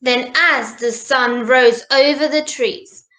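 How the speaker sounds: ordinary delivery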